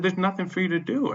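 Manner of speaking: high, indignant brush-off; retold dismissal. Tenant refusing the manager's help, indignant.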